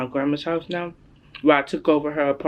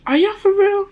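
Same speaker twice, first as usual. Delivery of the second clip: very high, louder incredulous question; imitated disbelief; 6 dB louder than usual. Daughter mimics mom questioning the pregnancy news, high incredulity. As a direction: squeak it out like a stunned mom.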